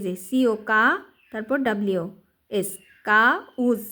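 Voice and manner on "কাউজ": "কাউজ" split as কা, উজ; teacher's two-part "কাউজ"; sounding out syllables. Shown as a demonstration. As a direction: say "কাউজ" in two parts, the second starting on উ. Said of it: "ডাব্লিউর উচ্চারণটা উ হবে".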